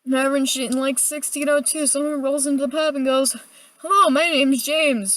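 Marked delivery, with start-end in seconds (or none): 3.8-5.2 mock Shakespearean greeting, slow and a bit louder. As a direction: ham up an Elizabethan stage hello. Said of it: "in Shakespearean".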